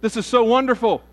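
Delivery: high, loud gushing delight; thrilled to find a fellow believer; delighted rescuer. Acted. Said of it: "the gentleman said".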